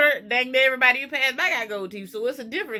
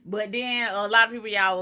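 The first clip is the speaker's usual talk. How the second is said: normal talking voice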